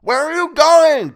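very high, very loud, drawn-out creature shriek; scream. A narrator's reading. Creature seeing captive head for temple, screaming. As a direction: screech after the one escaping. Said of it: "Toadface screamed".

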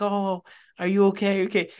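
high-pitched imitation of a sweet old lady. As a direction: play a concerned, kindly old woman. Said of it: "very, very sweet. And she's like".

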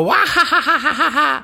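high, loud cackling witch laugh; witch cackle. Pretend. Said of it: "Would you go".

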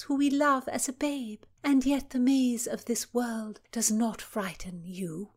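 high, measured noblewoman's voice; lady's voice. Acted. Cecilia volleying thanks back to Philomena, high and gracious. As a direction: lift it up and speak like a gracious lady.

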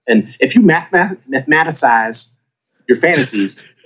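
hammed-up presidential voice with pauses; George Bush impression. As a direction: explain haltingly, pitch lifting toward the end. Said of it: "My George Bush is better".

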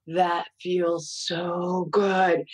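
very slow, drawn-out imitation of bliss; mock blissful. Mom imitating her little daughter on pillows, slow and blissful. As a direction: stretch every word, savoring it.

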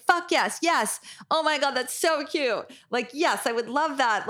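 high, gushing mock reaction; retold excited cheering. Therapist replying to client's text about calling the girl, excited.

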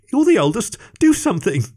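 frantic pleading. A narrator's reading. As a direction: beg in a panic. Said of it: "pleaded Greg frantically".

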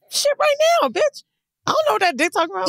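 high, fast excited outburst. Real, not acted.

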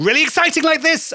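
high, loud burst of excitement; excited. Shown as a demonstration. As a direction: jump up in pitch and punch it with excitement. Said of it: "add a bit of excitement".

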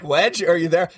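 high calling voice, teasing; mock calling out. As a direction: call out to a missing friend. Mocking Wedge gone silent.

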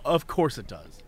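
mock weary deadpan. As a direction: drop it low like a tired local. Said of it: "as a native of Ohio".

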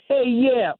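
commercial actor's put-on New York accent, slightly hammed; lightly mock a radio ad's New York cab driver; mock New York cabbie. Host imitating radio commercial that upsets him, mock New York accent. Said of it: "we hear a radio commercial".

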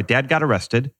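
usual speaking voice

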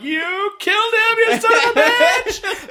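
high, slow, yelling accusation; a hero leaping on the table, hammed-up furious shout; mock furious shout. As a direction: scream it at the villain, hammed up. Retold furious movie shout.

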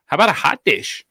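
playful imitation of a Wisconsin accent. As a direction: put on an Upper Midwest accent. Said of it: "doesn't sound like that".

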